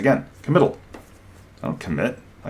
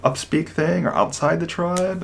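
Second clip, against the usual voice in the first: rising upspeak lilt, pitched well up; mock the upspeak by lifting the phrase; upspeak demo; 9 semitones higher than usual. Shown as a demonstration. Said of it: "talk with this up, upspeak thing".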